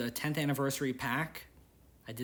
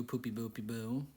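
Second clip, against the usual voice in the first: silly babble; 4 dB quieter than usual. Real, not acted. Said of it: "Don't know why I said it like that".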